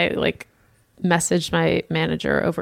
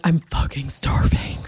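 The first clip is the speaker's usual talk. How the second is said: whisper; 4 semitones lower than usual. Retold or imitated. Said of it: "then I'm like".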